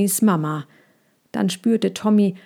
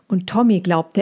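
plain reading voice

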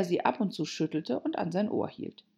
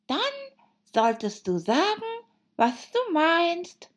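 March Hare, pedantic; 13 semitones higher, 4 dB louder than usual. Acted. March Hare telling Alice to mean it, high and pedantic.

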